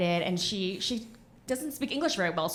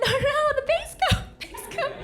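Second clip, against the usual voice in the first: much higher, laughing through it; desperately trying to calm things down; frantic high pleading. Retold or imitated. Daughter begging manicurist over angry mom, high and frantic.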